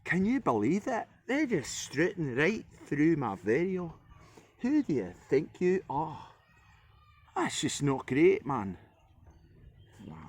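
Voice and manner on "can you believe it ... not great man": high, slow hammed-up Scottish accent. Mocking influencers, a hammed-up Scottish accent. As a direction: rant like an outraged Scot, pitched up. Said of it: "Scottish accent".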